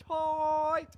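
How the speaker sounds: high, loud, stretched shout; thrilled whoop. Retold or imitated. Man told they're going straight to bed, thrilled whoop.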